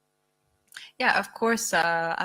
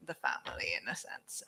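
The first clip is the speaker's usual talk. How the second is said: whispered; 8 semitones lower, 6 dB quieter than usual. Real, not acted. Trailing whispered aside.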